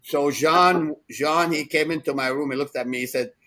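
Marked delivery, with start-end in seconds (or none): none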